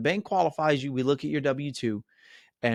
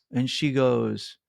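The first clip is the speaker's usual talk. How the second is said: ordinary talk, nothing marked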